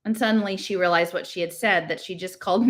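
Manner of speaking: ordinary delivery, nothing marked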